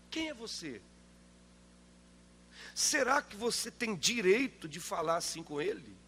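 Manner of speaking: normal talking voice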